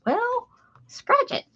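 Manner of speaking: very high, blunt grandma. Retold or imitated. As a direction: snap back like a grandma. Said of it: "she'd be like".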